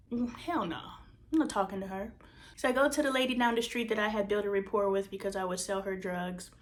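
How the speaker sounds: ordinary talk, nothing marked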